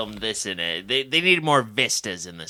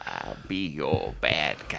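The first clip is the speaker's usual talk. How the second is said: slow, much lower sinister voice; low, slow mock villain; 8 semitones lower, 5 dB quieter, slower than usual. Joking in a put-on voice.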